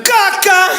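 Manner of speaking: respuesta seca en voz aguda de mamá; madre cortante. Retold or imitated. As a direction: imita a la madre que contesta de mala gana. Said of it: "¿Sus madres eran así?". (mamá cortante con voz aguda)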